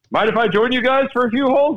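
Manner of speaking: high, bright-eyed Tom Watson ask, pitched well up; chirpy invite to join, voiced way up high; Tom Watson impression. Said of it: "giving your impersonation".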